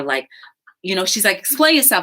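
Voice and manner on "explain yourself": demanding filmer. Retold or imitated. Filmer demands, retold quarrel.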